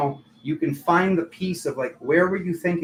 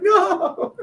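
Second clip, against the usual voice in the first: a much higher, louder, squeaky "No"; flat refusal; 4 dB louder than usual. Retold or imitated. Taylor Moon refusing, high squeaky mock no. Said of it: "She's like".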